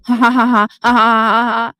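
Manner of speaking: staccato "ha ha ha" put on in mockery; deliberately phony laughter; fake mocking laugh. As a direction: laugh falsely at her, syllable by syllable.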